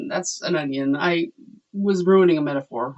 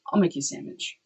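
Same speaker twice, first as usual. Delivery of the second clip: soft unvoiced whisper; 7 dB quieter, faster than usual. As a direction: breathe it out in a whisper. Dad voiced, whispered.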